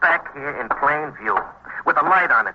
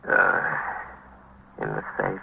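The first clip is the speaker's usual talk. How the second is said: much softer and lower, trailing off embarrassed; sheepish admission; 5 dB quieter, slower than usual. Acted. Shopkeeper admits to the captain the invoice was in the stolen safe, sheepish and much softer. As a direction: admit it reluctantly, barely audible.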